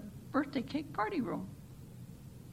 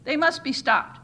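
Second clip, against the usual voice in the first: loud, higher demand; crowd shouting; 5 semitones higher, 8 dB louder than usual. A narrator's reading. The crowd loudly demands the church be stopped. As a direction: push it out as an angry demand. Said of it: "shouted the crowd".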